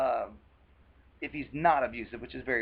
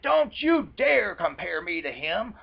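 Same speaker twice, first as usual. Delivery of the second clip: louder and slow, indignant; offended anger; 4 semitones higher, 7 dB louder, slower than usual. Retold or imitated. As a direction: bite out each word as a warning. Abuser offended at comparison.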